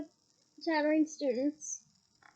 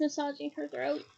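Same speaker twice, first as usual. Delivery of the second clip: plain reading voice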